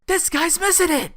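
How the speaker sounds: high, loud incredulous shout; real exasperated outburst; exasperated cry. Real, not acted. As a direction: yell it in disbelief. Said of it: "exasperated exclamation".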